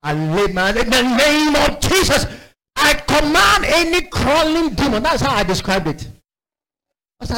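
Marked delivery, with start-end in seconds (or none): 0.8-5.0 high, forceful preacher's command; exorcism command. Retold or imitated. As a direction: re-enact casting out a demon.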